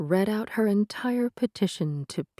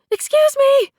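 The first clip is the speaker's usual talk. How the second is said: high, loud yell to clear the way; 12 dB louder than usual. A narrator's reading. Girl yelling at petitioners to let her through, loud. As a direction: shout it over the crowd. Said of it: "she yelled".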